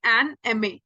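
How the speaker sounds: an M A said slowly with "an" before the "em" sound; slow demo. Shown as a demonstration. Teacher models an M A for students. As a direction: model an M A carefully. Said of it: "जब आप एमए बोलते हो तो आवाज क्या आ रही है".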